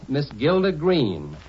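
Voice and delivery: ordinary talk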